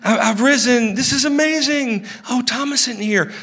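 lightly hammed-up excited Jesus. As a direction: play Jesus bubbling over with excitement. Acted excited risen Jesus.

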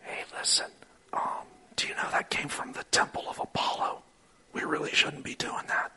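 hushed warning to a fellow believer; whisper. Retold or imitated. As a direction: lean in and whisper it, unvoiced and hushed. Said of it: "kinda leans in and goes".

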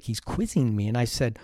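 normal talking voice

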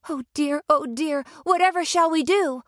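high, fretful oh dear; worried Dorothy. Acted. Dorothy alarmed at the dead witch, high and fretful. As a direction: fret anxiously.

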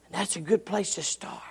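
breathy whisper, barely voiced. Real, not acted. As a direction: drop to a hush to close the point. Drops to near whisper.

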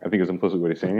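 usual speaking voice

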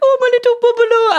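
very high, gushing pet voice; squeaky-high ironic cooing; baby talk. Retold or imitated. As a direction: coo over the cat in mock adoration. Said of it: "baby talk to their dog".

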